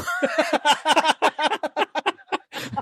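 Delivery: a long high burst of real laughter. Real, not acted. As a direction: crack up helplessly. Laughing at the host.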